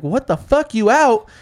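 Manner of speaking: high, loud disbelieving yelp; mock outraged shock. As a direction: yelp it like you can't believe it. Guy shocked at rejection.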